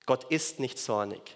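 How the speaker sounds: ordinary talk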